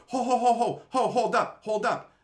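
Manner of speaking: high, loud rapid "hold up"; retold interruption. Acts out cutting the friend off.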